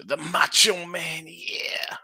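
slow, drawn-out wrestler voice; Macho Man Randy Savage. Acted. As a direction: stretch it out like a hyped wrestler. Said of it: "I like the Macho Man, Randy Savage".

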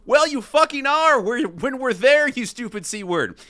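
high, heated comeback; acted angry retort. A narrator's reading. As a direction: argue back hotly, leaning on the insult. Acted retort, man in red.

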